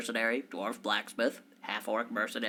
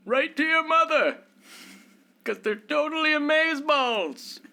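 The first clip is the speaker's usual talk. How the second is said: put-on old wizard; 6 dB louder than usual. Game master voicing the wizard pitching a quest, airy and high. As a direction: play the wizard as a flaky valley-talker, pitched way up.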